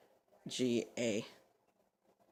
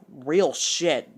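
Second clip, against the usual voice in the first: loud, drawn-out, raised-pitch outburst; loud exclamation; 4 semitones higher, 13 dB louder than usual. Retold or imitated. Reacting to what she just said, loud exclamation. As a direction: blurt it out much louder.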